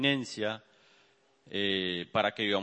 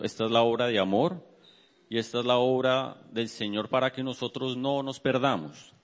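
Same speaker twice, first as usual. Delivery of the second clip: ordinary delivery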